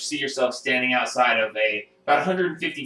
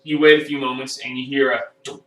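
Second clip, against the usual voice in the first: ordinary delivery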